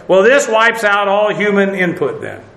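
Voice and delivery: ordinary talk, nothing marked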